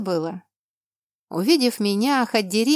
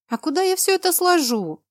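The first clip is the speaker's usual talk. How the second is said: high, loud indignant question; 10 semitones higher, 4 dB louder than usual. A narrator's reading. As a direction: protest in outrage. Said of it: "возмутилась".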